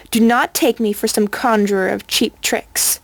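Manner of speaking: plain reading voice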